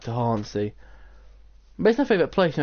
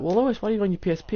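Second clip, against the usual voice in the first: quick, higher mock mum; higher, quick imitation of mum; mum's voice; 8 semitones higher, much faster than usual. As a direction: rattle it off like a nagging mum.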